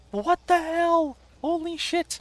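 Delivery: shrieking high and loud. Real, not acted. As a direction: squeal in shock. Shocked by flashing visuals.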